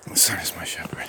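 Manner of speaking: low, soft whisper; whispered mantra. Real, not acted. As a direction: whisper it to yourself like a private prayer. Same mantra, repeated under breath.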